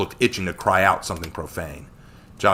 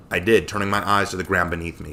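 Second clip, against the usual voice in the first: plain reading voice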